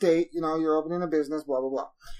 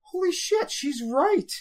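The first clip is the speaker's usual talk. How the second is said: surprised realization; 9 semitones higher, slower than usual. Retold or imitated. Realizing missing email.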